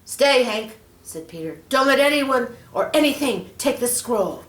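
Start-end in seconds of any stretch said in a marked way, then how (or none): 0.0-0.8 loud, raised command; firm command. Acted. Peter ordering his dog to stay, loud and firm. 1.7-4.4 loud, higher urgent order; firm command. Acted. Peter telling Hank to guard the scroll, loud.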